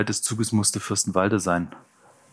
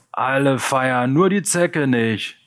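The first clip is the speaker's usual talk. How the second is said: higher, drawn-out mocking holler; bellowing; 6 semitones higher, 3 dB louder, slower than usual. A narrator's reading. Chief Nazi jeers at the motionless punk, bellowing. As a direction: holler it slowly across the group. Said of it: "brüllte der Obernazi".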